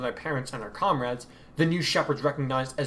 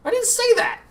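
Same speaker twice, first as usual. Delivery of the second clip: much higher, louder indignant protest; 8 dB louder than usual. Acted. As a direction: squeal your innocence. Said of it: "Odin protested".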